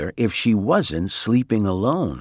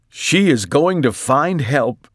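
a hint of hope, pitched up; lightly voiced excited husband; 5 semitones higher, 3 dB louder than usual. A narrator's reading. Mister Watson explaining Mercy's exit, mildly excited voice. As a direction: declare it with mild excitement.

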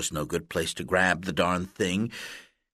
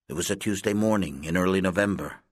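usual speaking voice

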